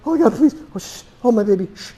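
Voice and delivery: high, desperate begging; pleading mother. Pretend. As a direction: plead and hush the baby at once.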